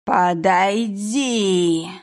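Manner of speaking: медленный, потусторонний зов; тянуть слово, как мертвец из колодца; голос призрака. Acted. Ghost calls from the well. (зловещий призрачный шёпот-зов)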